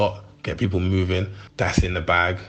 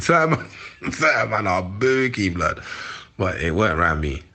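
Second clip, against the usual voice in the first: ordinary talk, nothing marked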